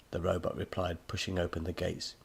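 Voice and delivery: ordinary delivery, nothing marked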